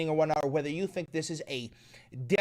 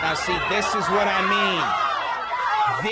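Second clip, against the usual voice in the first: loud slow shout; 3 semitones higher, 6 dB louder, much slower than usual. Real, not acted. Streamer shouting at chat about the video, loud slow shout. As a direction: bellow it out slowly, word by word.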